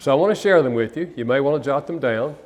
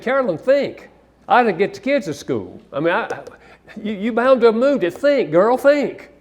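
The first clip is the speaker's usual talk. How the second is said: higher, rising impatient scolding, building; exasperated badgering; 9 semitones higher, 3 dB louder than usual. Husband scolding wife over lost briefcase, exasperated badgering. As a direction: hammer at her to think, voice climbing. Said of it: "Can you imagine me talking to my wife like that?".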